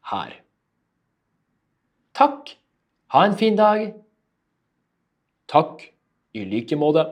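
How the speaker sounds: loud, lively cafe dialogue; cafe role-play. Acted. Teacher acting out a cafe order, loud and lively. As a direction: play barista and customer with energy.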